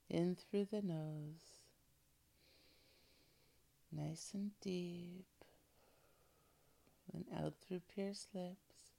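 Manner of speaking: slow, soft breathing guide; guided breathing. Acted. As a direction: calmly guide each breath. Leads breathing exercise.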